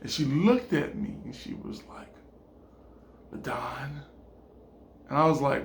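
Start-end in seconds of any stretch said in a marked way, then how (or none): 3.3-4.0 low, hushed call of his name; soft, lowered, ominous address; menacing quiet. Acted. Woman holding butcher knife addresses him, menacingly quiet.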